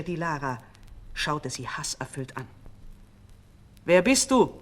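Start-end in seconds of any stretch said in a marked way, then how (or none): 3.8-4.6 louder, hostile challenge from the double; a frowning, suspicious false queen; frowning demand. A narrator's reading. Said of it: "mit zusammengezogenen Brauen".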